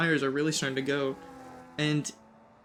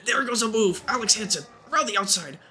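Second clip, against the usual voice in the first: excited commentary; 7 semitones higher than usual. Real, not acted. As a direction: shout the overtake as it happens. Overtake happens live in race.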